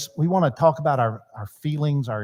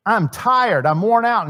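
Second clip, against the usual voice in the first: high, pleading exasperation; acted worn-out complaint, pitched up; exasperated dad; 6 semitones higher, 4 dB louder, slower than usual. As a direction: voice an overwhelmed father venting. Voicing the overwhelmed father's feelings.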